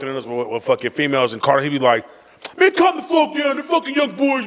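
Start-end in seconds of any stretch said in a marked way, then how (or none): 2.6-4.5 high imitation of dad; pitched-up dad voice, dismissive; dad telling mom off. Dad defending sons to mom.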